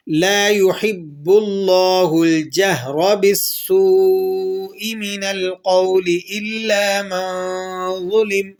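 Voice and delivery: slow melodic tajweed chant; slow chanted Arabic verse; Quranic recitation. Shown as a demonstration. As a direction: draw each vowel out in recitation.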